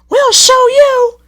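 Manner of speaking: very high, loud, slow threatening coach voice; spiteful coaches. A narrator's reading. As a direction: promise revenge.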